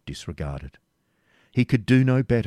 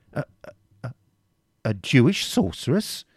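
stuttered start, then a much higher loud cry; stammering shock; 6 semitones higher, 3 dB louder than usual. A narrator's reading. Preceptor feigning horror at the charge, stammering. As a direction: act aghast and flustered. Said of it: "echoed Albert Malvoisin".

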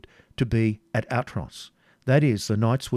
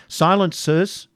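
high, loud, drawn-out order; commanding call for silence; 8 semitones higher, 5 dB louder, slower than usual. A narrator's reading. Waldemar silences the arguing knights, commanding.

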